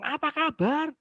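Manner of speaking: cheery greeting. Retold or imitated. Teacher greeting a silent class, cheerfully. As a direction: call out the hello high and lively. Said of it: "Saya itu sampai ngomong".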